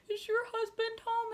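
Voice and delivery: high-pitched mock crying voice; hammed-up distressed whine; imitated tearful panic. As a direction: squeak it out as if in tears. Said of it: "why are you crying".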